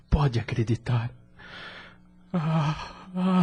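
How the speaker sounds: normal talking voice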